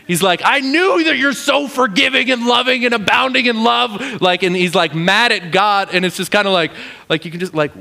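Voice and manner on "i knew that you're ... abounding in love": high, bitter praise of God's love; angry praise. Acted. Jonah angrily praising God, high and bitter. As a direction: praise God through gritted teeth. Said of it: "mad praising God".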